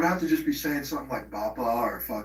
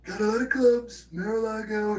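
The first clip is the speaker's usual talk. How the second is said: much higher, hammed-up retelling of dad's joke; mocking dad's joke; 9 semitones higher than usual. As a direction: squeak the joke back in a silly high voice. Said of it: "so I was like".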